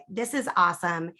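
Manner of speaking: ordinary talk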